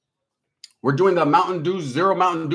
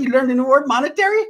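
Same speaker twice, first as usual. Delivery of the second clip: mocking; 10 semitones higher, 4 dB louder than usual. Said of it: "I said".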